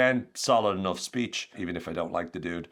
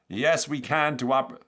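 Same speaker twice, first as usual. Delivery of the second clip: slow, raised campaign-speech voice; Barack Obama; 6 semitones higher, 4 dB louder, slower than usual. Acted. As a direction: attempt a presidential rally cadence, none too well. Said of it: "don't have a great Barack Obama impression".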